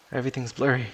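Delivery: ordinary delivery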